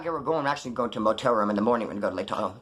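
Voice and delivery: ordinary delivery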